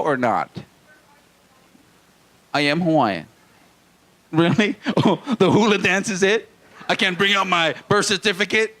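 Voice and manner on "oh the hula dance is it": ordinary talk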